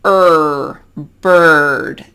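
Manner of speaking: Er, bird with a long held r-coloured vowel; curled-tongue r vowel. Shown as a demonstration. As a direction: curl the tongue through Er, then bird. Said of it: "you kinda have to curl your tongue".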